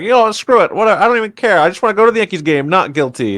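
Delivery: high, fast, careless shrug of a voice; mock juror who just wants to leave. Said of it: "he's like".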